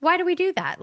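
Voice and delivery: high-pitched musing question; inquiring. Retold or imitated. Questioning an old family tradition, inquiring.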